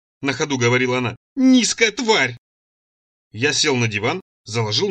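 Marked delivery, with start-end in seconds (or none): 1.4-2.4 high, very drawn-out insult; tearless sobbing insult. A narrator's reading. Цира follows him into the room, insulting through dry sobs. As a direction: stretch out each word of the insult, pitched up. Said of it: "всхлипывая без слёз".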